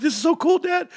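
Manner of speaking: very high-pitched child imitation; excited little kid. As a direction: gush to dad like a thrilled schoolkid. Voices himself as excited child.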